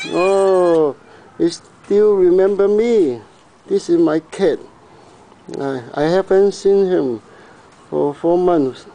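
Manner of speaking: high, doting pet talk; cooing at the cat. Real, not acted. Owner greeting his cat again, high cooing voice. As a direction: coo over a beloved cat. Praising cat that remembers him.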